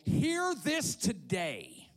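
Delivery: high, loud, drawn-out command; emphatic preacher's cry. Real, not acted. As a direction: proclaim it slowly at full voice. Climax after list of struggles.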